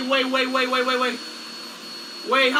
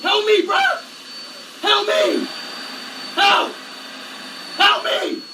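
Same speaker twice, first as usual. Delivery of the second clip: higher, urgent holler; desperate repeated calls for help; yelling for help; 4 semitones higher, much slower than usual. Real, not acted. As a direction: shout for someone to come rescue you. Stuck, yelling to Jerome for rescue.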